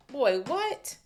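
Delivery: high loud disbelief; incredulous. Real, not acted. Reader incredulous.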